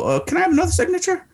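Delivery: high, fast, deadpan innocent request; mock-innocent high ask. Asking Charles Barkley for a second signature, high innocent voice. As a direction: ask sweetly as if nothing is up. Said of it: "I look at him calmly, plainly, go".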